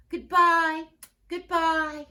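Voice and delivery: high, loud call; calling after. Acted. Teacher calling goodbye after her leaving students, loud and high. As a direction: call after the departing kids. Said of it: "Missus Hartwell called after them".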